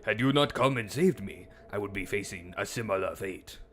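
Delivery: lightly put-on Korean accent, grave like a wise elder; mock Korean accent. For the stream chat, a lightly put-on Korean accent. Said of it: "deep Korean accent".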